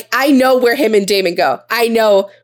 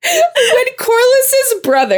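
very high, slow, laughing through words; real laughing squeal; 14 semitones higher, much slower than usual. Real, not acted.

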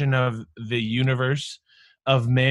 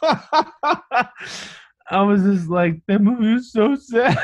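laughing through it, voice pitched way up; 10 semitones higher, 5 dB louder, much slower than usual. Retold or imitated. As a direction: squeak out the words between laughs. Laughing retelling own tearful reply.

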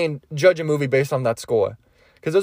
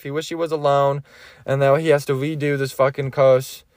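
ordinary talk, nothing marked

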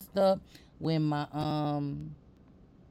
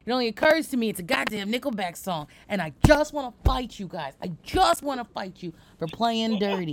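loud, playful threat to chat; hammed-up outrage at chat; mock fighting talk; 4 semitones higher, 6 dB louder than usual. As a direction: pretend to pick a fight with viewers. Mock threat to Twitch audience.

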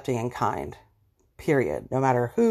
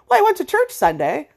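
much higher, louder, self-righteous mock; smug churchgoer; 11 semitones higher, 4 dB louder than usual. Judgmental person excusing themselves with church, high mock. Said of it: "turn around and say".

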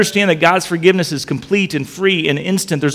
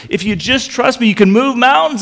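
higher and louder, building to mountains; rising promise; 9 semitones higher, 4 dB louder than usual. Acted. Preacher voicing God's promise, higher and louder. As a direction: lift it up like a promise from God. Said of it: "he says".

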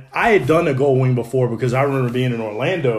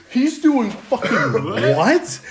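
laughing; 9 semitones higher, much slower than usual. Retold or imitated. Retold disbelief, laughing.

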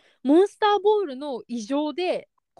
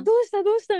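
retold flustered concern; 9 semitones higher, faster than usual. Flustered man checking on crying trainer.

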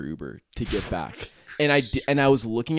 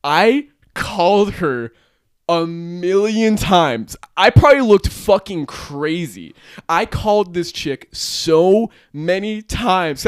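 higher, slow, drawn-out emphasis; exasperated emphasis; 5 semitones higher, 5 dB louder, slower than usual. Real, not acted. Telling friend about calling the vanished girl, exasperated emphasis. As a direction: lean hard and long on key words.

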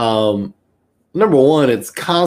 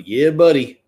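Southern Alabama drawl; 3 semitones higher, 4 dB louder than usual. Shown as a demonstration. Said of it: "that was Alabama".

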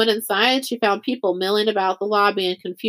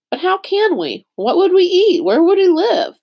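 high, louder, distressed questions; mounting disbelief, voice pitched well up; alarmed questioning; 9 semitones higher, 6 dB louder than usual. A narrator's reading. Girl learns they must fend for themselves, alarmed questioning. Girl confused at being sent away.

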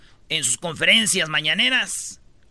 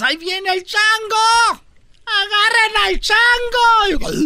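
high, loud, slow alarm cry; panicked shouting; 13 semitones higher, 7 dB louder, much slower than usual. Acted. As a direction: yell to warn the whole town. Crowd shouting about escaped monkey.

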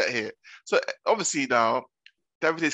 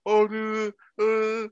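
high, put-on wail; mock bawling; 6 semitones higher, much slower than usual. Mimicking a housemate bawling after being called a liar, mock tearful. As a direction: imitate someone bursting into tears. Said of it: "And she starts bawling".

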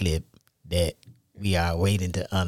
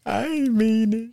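high, slow, put-on innocent voice; mock innocent; 12 semitones higher than usual. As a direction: play dumb, drawn out and high. Husband plays innocent.

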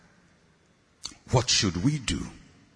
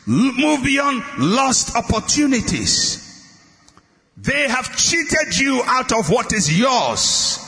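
fiery preaching; 9 semitones higher, slower than usual. Acted. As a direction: preach it out to the whole church at full force. Preacher at full force.

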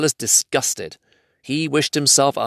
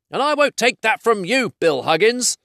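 high, indignant troll retort; raised, offended troll voice; quarrelling troll; 12 semitones higher than usual. Acted. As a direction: square up to your mate for a fight. Trolls quarrel before a fight.

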